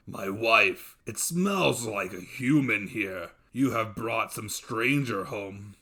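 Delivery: a giant sniffing out a stranger; suspicious dev. Acted. Dev accusing his wife, slow and low.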